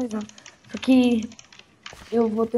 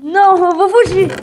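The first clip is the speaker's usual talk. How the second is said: loud panicked shout, higher; panicking gamer; 5 semitones higher, 6 dB louder than usual. Real, not acted. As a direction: yell it while running for your life. Fleeing an attacker in game.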